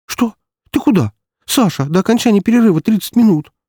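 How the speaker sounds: high-pitched, startled woman; incredulous, agitated questioning; alarmed protest. A narrator's reading. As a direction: object urgently to someone walking off. Marina protests as Sasha leaves.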